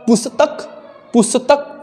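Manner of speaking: पुस्तक split as pusa-tak, extra vowel after s; wrong pronunciation. Shown as a demonstration. Teacher rejects a wrong form, पुस्तक as pusatak. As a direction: slip an a into पुस्तक, pusatak, pitched high. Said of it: "ये भी गलत है".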